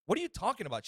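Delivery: high, loud mock protest; jabbing back. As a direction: imitate an indignant driver jabbering at a cop. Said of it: "She just starts jabbing at him".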